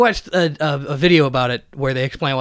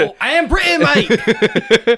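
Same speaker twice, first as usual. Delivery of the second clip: high-pitched, laughing hammed-up British; British accent; 7 semitones higher, 4 dB louder, much slower than usual. Comic suddenly remembering the bit, high British accent. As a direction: lurch back into a silly British accent. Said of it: "those versions of us are actually British".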